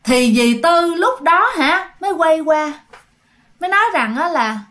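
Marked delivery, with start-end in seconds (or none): none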